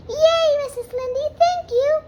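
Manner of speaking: high, loud delighted yay; squealing girlish thanks; thrilled cheer. Acted. Anne thrilled by roses.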